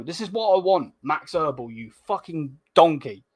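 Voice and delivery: fired up over good news; hyped up. Real, not acted. Celebrating transfer news on stream.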